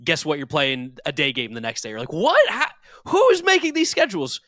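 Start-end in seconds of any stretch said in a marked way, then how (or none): 2.1-4.4 very high, loud, exasperated yell; outraged disbelief. Acted. Fan ranting about a packed game schedule, outraged and incredulous. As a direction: blow up in high-pitched disbelief.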